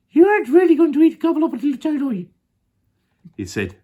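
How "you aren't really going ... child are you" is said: very high, fast, loud alarmed monkey; Mugglewump. Acted. Monkey horrified by the crocodile, very high and fast. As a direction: squeal it out in a panicky rush. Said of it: "went pale and began to shake".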